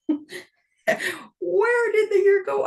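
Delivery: high, slow disbelieving wail; drawn-out amazed cry; disbelief. Real, not acted. Disbelief at how fast time passed.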